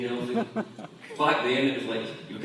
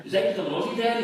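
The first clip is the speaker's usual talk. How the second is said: put-on raised, hopeful boy voice; hopeful kid; 7 semitones higher, faster than usual. Son asking father, lightly voiced kid. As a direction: ask Dad like an eager child. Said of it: "He says".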